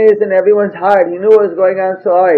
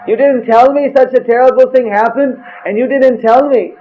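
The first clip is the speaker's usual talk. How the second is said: higher, rising reproach of someone left uninformed; scold, hurt to be kept out; upset reproach; 6 semitones higher than usual. Retold or imitated. Lord Chaitanya reproaching Srivas for silence, upset higher reproach.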